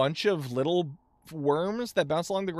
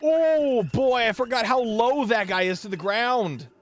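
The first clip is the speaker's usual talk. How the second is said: surprised; 6 semitones higher, 4 dB louder than usual. Real, not acted. Sudden enemy encounter in game.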